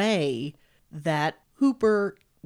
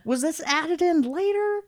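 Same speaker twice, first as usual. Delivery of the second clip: high put-on voice; baffled question in falsetto, climbing high; puzzled falsetto; 11 semitones higher than usual. Viewer puzzled by Leatherface's voice, high falsetto.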